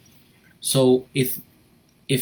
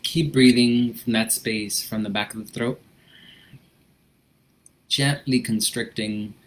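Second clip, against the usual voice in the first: gentle instructor easing the class into breathing; guided breathing. Acted. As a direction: calmly guide them through the breath.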